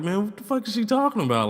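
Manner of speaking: high, quick incredulous question; baffled disbelief. Retold or imitated. His inner reaction to the drug offer, high baffled disbelief. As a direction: pitch it way up, rushed and bewildered.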